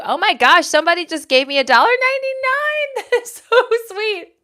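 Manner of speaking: high, loud delighted cry; excited squeal. Real, not acted. Viewer gifts money, host squeals with delight. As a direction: gush with joy at the gift.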